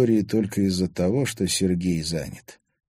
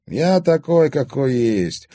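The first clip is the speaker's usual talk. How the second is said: haughty, higher and louder on Я; 8 semitones higher, 6 dB louder, slower than usual. A narrator's reading. Anton answers Milana's reproach, haughty and proud. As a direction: proudly declare it, chin up. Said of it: "надменно заявил Антон".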